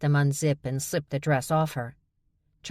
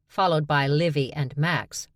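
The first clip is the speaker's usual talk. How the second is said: usual speaking voice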